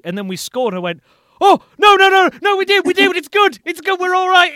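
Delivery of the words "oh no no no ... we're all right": high, very loud, tumbling repeats; frantic goal reaction. Retold or imitated. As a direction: shout it in frantic relief. Acts out reaction to scoring.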